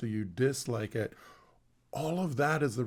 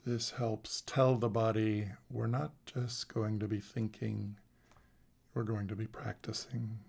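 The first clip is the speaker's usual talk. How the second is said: guided meditation. Acted. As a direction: guide them into the body, calmly and unhurried.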